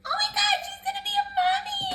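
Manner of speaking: very high, squeaky gush; excited squeal. Pretend. Gushing that she's gonna be a mommy, excited squeal. Squealing over becoming a mother.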